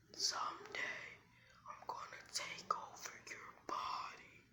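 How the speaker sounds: slow, drawn-out sinister whisper; hushed beast's threat; menacing fox. Acted.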